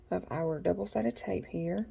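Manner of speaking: ordinary delivery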